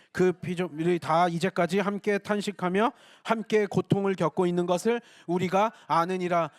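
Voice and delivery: solemn Bible recitation; scripture reading. Real, not acted. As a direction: read the verse aloud with the congregation. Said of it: "시작".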